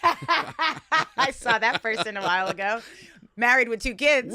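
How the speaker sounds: loud, high laughing confession. Real, not acted. Telling friend about seeing that person, laughing hard. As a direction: squeeze the words out through laughter. Laughing over old shared secret.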